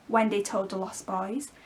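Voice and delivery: ordinary delivery, nothing marked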